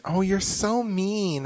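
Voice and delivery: slow, high, fake-sweet voice; [name] impression. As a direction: coo it high and slowly. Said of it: "telling Simon".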